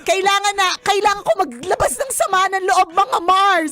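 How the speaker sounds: put-on high, urgent girl-talk with a laugh in it; woman venting to girlfriends. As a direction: imitate a woman desperate to unload. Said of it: "Parang babae lang talaga yung ano".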